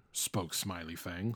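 usual speaking voice

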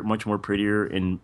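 ordinary talk

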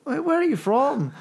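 hammed-up counter guy asking a question; shop assistant voice. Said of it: "the sort of guy working behind the counter".

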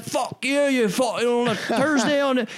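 high, garbled furious ranting; retold angry outburst; mock angry yelling. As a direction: imitate a raging man pinned down. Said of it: "he's so angry right now".

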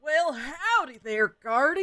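put-on high, folksy Texan accent. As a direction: greet them in a thick Texan accent. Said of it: "a really thick Texan accent".

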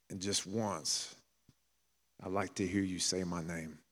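soft, weary prayer at the dinner table; hushed plea. Retold or imitated. Preacher voicing Martin Luther King praying softly in despair. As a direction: quietly pour it out, like a tired man praying alone.

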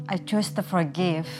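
ordinary delivery

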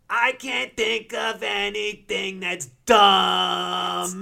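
loud, slow growly shout; Oscar voice. Retold or imitated. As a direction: belt it like a grumpy Muppet. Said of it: "it opens up with".

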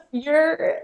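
high, drawn-out voice of someone in stitches; mimicking helpless laughter. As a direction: play someone laughing too hard to cope. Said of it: "she was on the ground rolling, wiping her tears".

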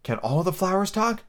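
high, tentative question; timid voice. A narrator's reading. Alice shyly asking the Tiger Lily, mildly timid. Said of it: "she spoke again in a timid voice".

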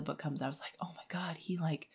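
hushed, breathless whisper of realization; whispered shock. Retold or imitated. Reader recalls the twist, whispering in shock. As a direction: whisper it like a secret dawning. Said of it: "recounts realization in hushed voice".

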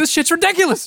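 very high exasperated squeak. Retold or imitated. Retelling the end of a bedtime tirade at John, squeakily angry. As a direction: let the mock rant peak way up high. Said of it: "my voice is getting higher as we go on".

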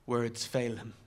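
plain reading voice

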